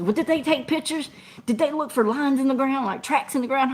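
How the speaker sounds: high, eager questions; lightly voiced fussy boss; quizzing boss. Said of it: "my safety director says".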